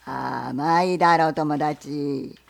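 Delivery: low, loud, slow gruff question; bear. Acted. Bear asks the fox what he sells, low and loud.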